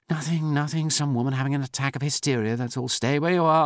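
high, urgent dismissive orders; agitated, high-pitched command. A narrator's reading. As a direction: brush it off, then order him to stay put. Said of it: "commanded Doctor Steiner".

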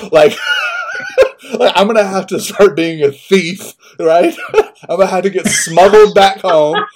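high, loud laughing through the words. Real, not acted. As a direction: laugh at your own bad luck. Laughing at own misfortune.

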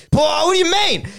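high-pitched, incredulous yelp; indignant outburst. Acted. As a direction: act out loud, offended protest. Acted defensive outburst in retold row.

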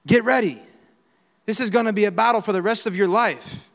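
normal talking voice

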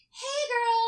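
high, loud imitated hello; gushing greeting. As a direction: greet a friend with exaggerated delight. Acts friend's mom greeting her.

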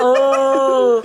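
loud, high, strained grunt; hammed-up monster noise; monster groan. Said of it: "You think a Frankenstein monster noise".

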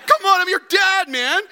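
high, loud, laughing protest of a hurt dad; mock indignation. Dad protesting to his son, high, loud mock offence. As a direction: play the wounded father, half laughing. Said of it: "My reaction was like".

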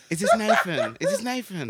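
put-on prank-call girl voice. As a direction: imitate a girl prank caller while amused. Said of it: "Like, girls, isn't it?".

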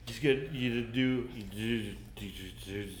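low, soft fatherly reassurance; hushed, halting comfort for a son; consoling father. Acted. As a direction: talk a crying kid down quietly. Father consoles crying son, acted.

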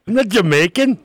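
high, loud mock Aussie drifting Jamaican; botched Australian accent. Co-host told he sounds Jamaican, indignant mock Australian. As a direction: protest in a hammed-up Australian accent. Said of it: "Sounds Jamaican".

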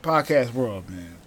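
ordinary talk

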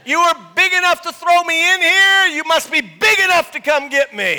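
high, loud challenge; defiant shout. Acted. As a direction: dare the king at full voice. Acted defiant dare to the king.

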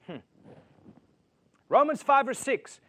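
ordinary delivery

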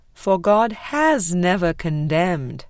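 ordinary delivery

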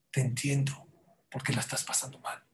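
soft unvoiced comfort; tender whisper. Real, not acted. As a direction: breathe it gently to someone hurting. Tender words to struggling child.

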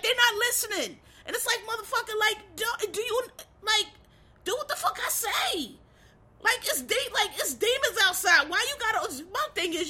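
high, worked-up mock scolding; exasperated rant. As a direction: voice pitched high in frustration. Venting at characters who won't listen.

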